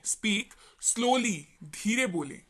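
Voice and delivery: each word stretched out slowly. Shown as a demonstration. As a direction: drag out Speak slowly word by word, showing what it means. (बहुत धीरे-धीरे खींचकर बोला गया)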